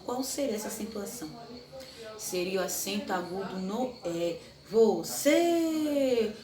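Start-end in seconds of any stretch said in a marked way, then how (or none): 4.7-6.3 "Você" drawn out high with a closed final E; teacher models the closed E in "Você". Shown as a demonstration. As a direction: stretch the closed E at the end of "Você". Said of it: "o som fechado do E".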